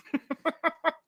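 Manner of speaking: sudden high-pitched laugh; squealing real laugh. Real, not acted. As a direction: burst out laughing. Laughing at Clancy's remark.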